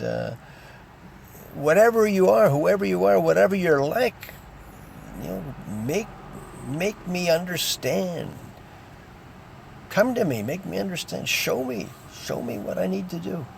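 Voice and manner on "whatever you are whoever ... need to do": higher-pitched, pleading prayer to God; demonstrated prayer. Shown as a demonstration. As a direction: plead upward for guidance, with pauses. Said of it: "something like that".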